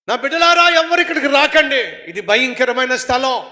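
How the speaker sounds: put-on high, loud cry to the children; shouted warning. Father in hell calling to his children, shouted warning. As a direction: yell to keep them away. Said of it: "బిడ్డలను చూసి అరుస్తున్నాడు".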